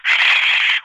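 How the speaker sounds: hushed shh; shushing. Retold or imitated. Umpire hushing the stadium crowd, a hissed shh. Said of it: "the umpire's going".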